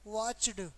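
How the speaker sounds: वॉच्ड as one syllable, the ड just a short stop; half-sounded ending. Shown as a demonstration. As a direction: clip the ending of वॉच्ड to a bare ड, no vowel before it. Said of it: "वॉच है तो आधा कर दो". (वॉच्ड में ड आधा, बिना 'ए' के)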